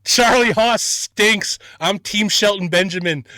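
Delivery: loud, high, drawn-out jeer; playful heckling. Pretend. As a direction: heckle like a partisan wrestling fan.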